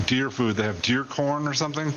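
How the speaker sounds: usual speaking voice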